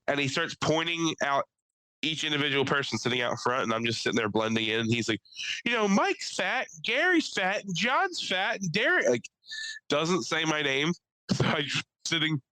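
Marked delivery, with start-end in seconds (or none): 5.7-9.1 high-pitched, insistent listing; mock arguing. Man at open mic pointing out each person, mock arguing. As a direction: count people off in a raised, argumentative voice.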